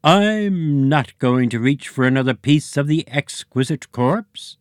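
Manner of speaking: high, gurgly squid creature. A narrator's reading. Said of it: "with a squid voice".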